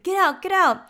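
high, loud retold order; mock yell. Soldier to the others in the stuck cart, mock yell. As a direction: imitate someone urgently yelling everyone out. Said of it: "he yells for everyone to get up".

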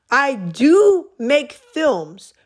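slow, deliberate wording with "do" held long; emphatic correction. Real, not acted. Speaker fixing their own slip about making films, emphatic correction. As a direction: stretch out "do" slowly and loudly.

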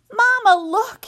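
high, loud excited squeak; squeaky shout. Acted. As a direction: squeal it out to mama. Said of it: "he squeaked".

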